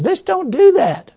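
high, slow whiny voice of the flesh. Retold or imitated. The preacher voicing his own flesh, slow and whiny. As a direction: drag it out like a sulky inner voice. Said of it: "my flesh says".

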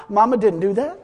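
ordinary delivery

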